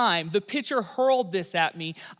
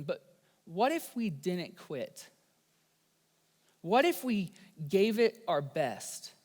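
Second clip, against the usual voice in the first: quieter, intimate sermon voice; soft reflective preaching; 3 semitones lower, 3 dB quieter than usual. Acted. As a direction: draw them in gently and earnestly. Softer reflective preaching to listeners.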